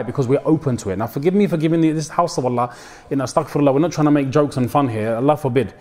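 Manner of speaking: ordinary talk, nothing marked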